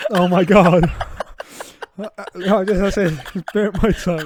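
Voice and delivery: loud, laughing through pain. Real, not acted. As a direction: laugh while wincing. Burnt tongue, laughing.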